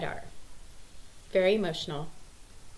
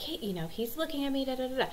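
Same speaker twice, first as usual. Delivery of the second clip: imitating complaining daughter; 6 semitones higher, faster than usual. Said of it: "And she's like".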